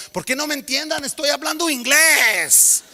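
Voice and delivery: high, loud indignant Spanish; confused kid. Retold or imitated. Kid insisting he speaks English, high and indignant.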